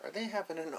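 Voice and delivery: plain reading voice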